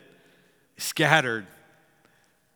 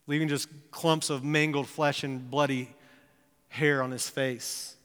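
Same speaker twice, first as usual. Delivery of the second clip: ordinary delivery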